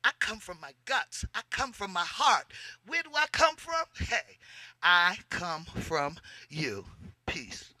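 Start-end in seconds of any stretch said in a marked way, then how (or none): none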